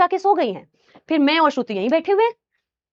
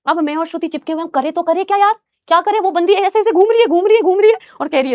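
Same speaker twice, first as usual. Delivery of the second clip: usual speaking voice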